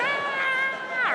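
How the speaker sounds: very high, hammed-up squeal; mock delighted scream. Acts own delighted scream.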